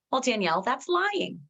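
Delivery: high-pitched imitation of a doubter; mock objection. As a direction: protest it as a sceptical listener. Voicing sceptical listeners' objection.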